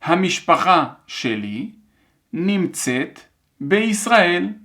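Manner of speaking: careful dictation in separated chunks; measured phrase-by-phrase dictation. Shown as a demonstration. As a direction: read it out for learners to write, pausing between chunks. Je répète.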